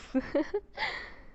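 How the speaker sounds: a light high chuckle, breathy and mocking; amused chuckle. Captor laughs at her squirming prey, amused. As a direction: laugh at the captive's fear. Monster woman mocks her captive.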